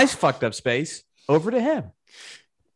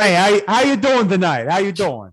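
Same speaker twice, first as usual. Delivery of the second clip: hammed-up New Jersey greeting, pitched up and louder; New Jersey accent; 3 semitones higher, 5 dB louder than usual. Co-host playing the scammer on request, mock New Jersey accent. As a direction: play a pushy New Jersey guy. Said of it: "do your best New Jersey accent".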